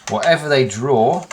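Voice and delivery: normal talking voice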